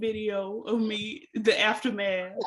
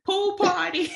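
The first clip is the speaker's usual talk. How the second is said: high, loud sassy eyelash voice; voicing the eyelashes; 10 semitones higher, 6 dB louder than usual. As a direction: playfully shout it as the eyelashes. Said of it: "your eyelashes said".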